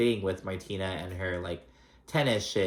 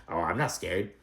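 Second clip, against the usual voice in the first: mocking, dismissive; 3 semitones lower, 4 dB quieter than usual. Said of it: "you're obviously scared if you're like".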